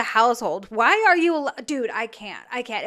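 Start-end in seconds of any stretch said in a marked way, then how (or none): none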